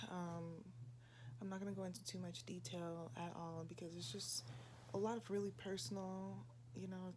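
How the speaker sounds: soft, hushed, keeping voice down. Real, not acted. Talking hushed in another room.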